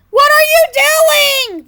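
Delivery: very high, loud, slowed shriek of shock; shocked yell. A narrator's reading. Other birds yelling in shock at the brothers. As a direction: scream it in disbelief. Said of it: "the other birds yelled in shock".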